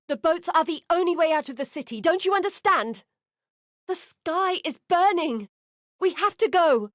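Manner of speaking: pushing someone toward the boats as the sky burns; frantic fleeing citizen; panicked plea. Acted. Panicked citizen fleeing burning city.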